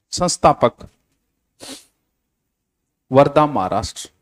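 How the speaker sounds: measured note dictation with long pauses. Real, not acted. Teacher reading out notes for students to copy, dictation. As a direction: dictate phrase by phrase for students to write.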